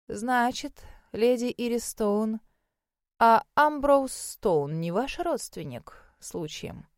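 put-on slow, deliberate elderly examiner; old mage, probing. Voices the old mage, intrigued. (старый маг, медленно и испытующе)

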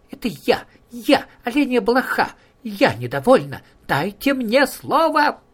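squeaky little flea voice; tiny high voice. Acted. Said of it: "тоненький голосок".

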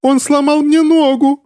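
slow, extremely high-pitched squeal; shrieking. A narrator's reading. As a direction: squeal it out in pain. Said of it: "верещал хозяин трости".

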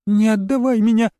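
put-on high scared child voice; frightened girl. A narrator's reading. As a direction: plead like a terrified little girl. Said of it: "прошептала она мне на ухо".

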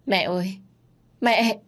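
rising anguished cry of mẹ; wailing for mother. Acted. Son wailing over his mother's body in grief. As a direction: cry out to your dead mother, breaking. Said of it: "bật khóc".

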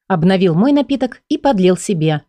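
usual speaking voice

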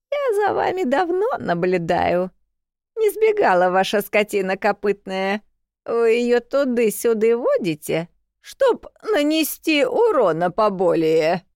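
высокий старушечий голос, обвиняя; сварливая бабка обличает; grumpy old woman. A narrator's reading. (старуха обвиняет, дребезжа)

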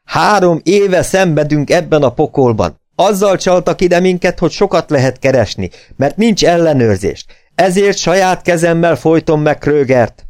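magas, emelt hangú, elkeseredett düh; dühös kifakadás. A narrator's reading. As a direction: fakadj ki emelt hangon, indulatosan. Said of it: "két öklével verte a párkányt". (dühösen kifakadva)

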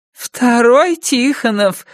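slow, high, doting coo; cooing mother-in-law. Acted. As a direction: coo it like a proud grandma. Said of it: "курлыкала свекровь".

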